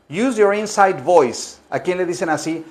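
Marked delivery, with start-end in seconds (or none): 0.1-1.6 "Use your inside voice" despacio y claro; pronunciación lenta. Shown as a demonstration. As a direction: dicta cada palabra. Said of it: "Usar se dice to use". (dictado lento y claro)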